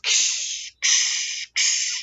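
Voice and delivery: drawn-out hissy "ksh" crashes; imitated breaking noise. Inmate imitating the sound of his stuff breaking. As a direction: mimic things being smashed, three times over. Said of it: "hear shit breaking".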